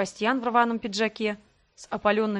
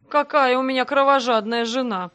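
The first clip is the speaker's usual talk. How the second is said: loud, higher mocking exclamation; с усмешкой; 4 semitones higher, 7 dB louder than usual. A narrator's reading. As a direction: taunt her with mock admiration. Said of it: "усмехнулся он". (громко, с издёвкой)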